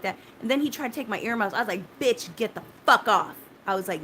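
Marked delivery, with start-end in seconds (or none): 2.0-3.4 loud, sharp retold curse at him; loud angry outburst, retold; retold yell. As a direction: fire it off like you're shoving him away. Retold yelling at the medic.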